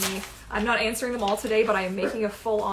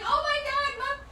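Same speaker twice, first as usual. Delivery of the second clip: high-pitched imitation of an excited pet; voicing excited Alfie. Speaking for Alfie to his mom, excited squeal. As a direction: squeal it as the overexcited dog.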